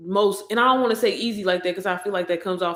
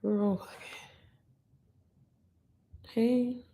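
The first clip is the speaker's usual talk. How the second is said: ordinary talk, nothing marked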